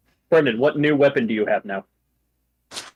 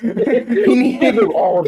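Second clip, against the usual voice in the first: laughing; 9 semitones higher, 5 dB louder, slower than usual. Real, not acted. Laughing while suggesting artillery.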